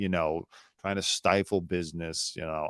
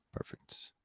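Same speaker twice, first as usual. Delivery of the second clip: whisper; 14 dB quieter than usual. Real, not acted. Host finds the screen.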